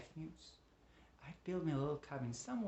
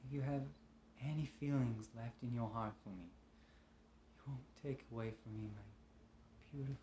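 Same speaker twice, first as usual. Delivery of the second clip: tender pleading; 7 dB quieter than usual. Acted. Man begs his love for his daughter, tender pleading. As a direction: plead gently, with halting softness. Tender plea to beloved and child.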